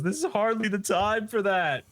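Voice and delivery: high, urgent retort; exasperated scolding. Retold or imitated. Takakura to Quinta in mid-fight, exasperated.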